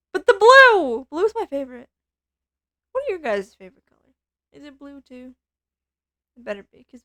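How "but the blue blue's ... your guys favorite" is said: excited. Real, not acted. Kid gushing over blue.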